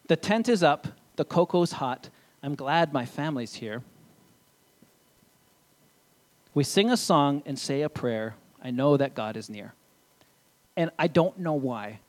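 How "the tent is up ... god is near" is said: gentle storybook reading, unhurried; reading to children. Acted. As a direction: read it like a bedtime book to little ones. Reads a children's book aloud.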